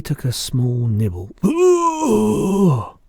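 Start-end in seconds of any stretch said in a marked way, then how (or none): 1.4-3.0 high, loud "ugh" of revulsion; acted retch of disgust, pitched high; spluttered disgusted cry. A narrator's reading. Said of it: "she spluttered".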